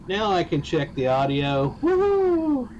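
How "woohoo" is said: high, loud whoop of relief; a triumphant woohoo; excited cheer. Real, not acted. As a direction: whoop it out, delighted. Cheering once audio works.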